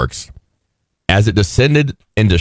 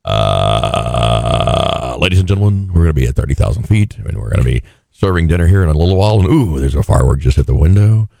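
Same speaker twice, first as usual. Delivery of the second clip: low, fast, breezy captain announcement; deep airline captain on the intercom; Quagmire pilot voice; 6 semitones lower than usual. Retold or imitated. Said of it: "Quagmire does his pilot voice".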